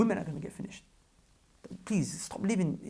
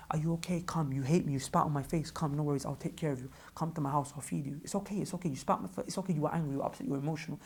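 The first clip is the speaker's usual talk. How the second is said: soft, low, forgiving reassurance; tender compassion; 3 semitones lower, 6 dB quieter than usual. Acted. Consoling a person who spat on him, tender. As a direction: gently welcome someone who wronged you. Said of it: "softness and tenderness and compassion".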